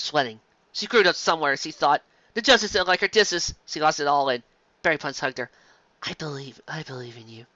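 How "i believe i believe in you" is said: much softer, gentle; tender reassurance. Acted. Berry Punch comforting a worried Carrot Top, soft. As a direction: comfort a scared friend.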